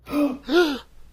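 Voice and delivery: a sharp, high, scandalised gasp; shocked high gasp. Acted. A stranger's offer makes him gasp in high shock. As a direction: gasp as if deeply offended.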